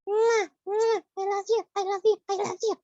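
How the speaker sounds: put-on squeaky child puppet, cheerful farewell; high puppet goodbye. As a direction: wave the kids off as the puppet.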